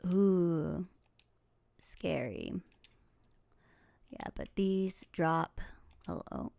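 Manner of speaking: usual speaking voice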